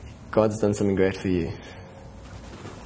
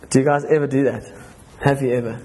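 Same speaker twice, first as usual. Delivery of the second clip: ordinary talk, nothing marked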